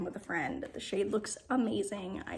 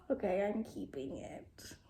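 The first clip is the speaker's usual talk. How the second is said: whisper; 4 semitones lower, 6 dB quieter than usual. Real, not acted. Hushed delight at blush.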